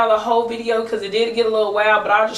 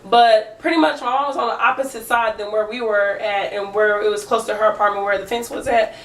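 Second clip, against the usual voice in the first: usual speaking voice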